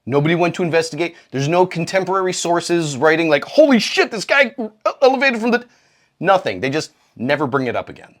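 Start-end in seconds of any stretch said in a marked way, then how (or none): 3.4-5.7 higher, excited report; hammed-up shock; mock amazed chronicler. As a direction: gush like breaking news.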